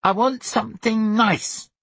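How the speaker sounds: put-on very high, slow whining child; little boy's high-pitched demand; Sonny. A narrator's reading. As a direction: whine it like a demanding small boy. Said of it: "Sonny said".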